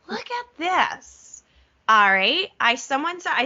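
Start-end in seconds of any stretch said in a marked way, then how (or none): 0.1-1.1 high, slow excited reveal. Real, not acted. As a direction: draw them in with delight.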